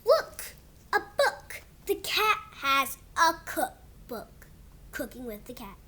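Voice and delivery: slow, sing-song storybook read; reading to kids. Acted. Kid reading his book to viewers in storybook voice. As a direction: read it like a bedtime book for little ones. Reads picture book aloud.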